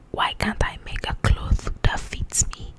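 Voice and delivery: ordinary delivery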